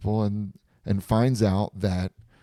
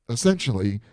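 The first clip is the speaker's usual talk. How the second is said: normal talking voice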